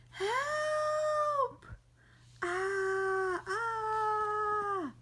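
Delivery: high, panicked cry for help; squeaky high frightened cry. Acted. Character in danger cries out.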